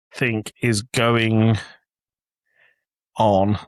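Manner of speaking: slow, drawn-out text dictation; dictating a text to his wife, slowly. Retold or imitated. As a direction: read it out word by word as you type. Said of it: "Tim says".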